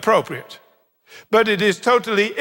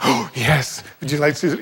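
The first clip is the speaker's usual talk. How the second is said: gasp, then a lower eager grandma voice; delighted grandma gasp; 6 semitones lower than usual. Retold or imitated. Grandma asked about grandchildren, gasping with lower-voiced delight. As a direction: gasp and light up at the mention of grandchildren. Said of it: "a lot of you grandmas say".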